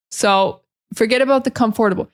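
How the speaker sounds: normal talking voice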